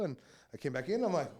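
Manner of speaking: usual speaking voice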